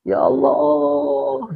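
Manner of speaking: slow drawn-out longing "Ya Allah"; yearning cry. Retold or imitated. Heart crying out for God, slow longing sigh. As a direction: stretch it like a soul missing God. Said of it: "Menjerit dia, merindukan Allah".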